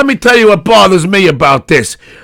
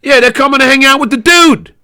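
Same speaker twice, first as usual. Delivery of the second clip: ordinary talk, nothing marked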